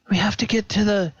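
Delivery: repeated stammered take; high and quick; flustered. Acted. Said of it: "he's like".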